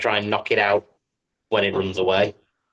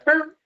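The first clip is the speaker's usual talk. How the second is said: dog yelp. Acted. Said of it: "and go".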